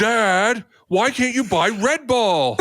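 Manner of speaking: put-on high, whiny spoiled kid; whiny brat voice. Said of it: "whiny voice brat".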